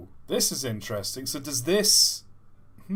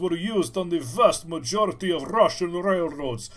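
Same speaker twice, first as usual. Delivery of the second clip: hammed-up Russian accent with rolled R's, higher voice; over-the-top Russian accent; 4 semitones higher, 4 dB louder than usual. Streamer reading locomotive history aloud, over-the-top Russian accent. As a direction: lecture grandly in a thick Russian accent. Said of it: "that over-the-top Russian accent".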